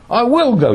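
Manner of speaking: "will" high and loud, insistent; stress on WILL. Shown as a demonstration. Teacher showing emphasis by tone, "will" stressed hard. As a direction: hit the word "will" with defiant force. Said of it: "I can emphasize with tone of voice".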